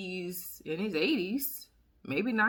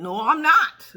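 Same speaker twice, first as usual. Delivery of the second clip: higher and much louder no; loud denial; 8 semitones higher, 12 dB louder, slower than usual. Real, not acted. Denying gossip about Ambien, a loud firm no. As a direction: shout it down firmly.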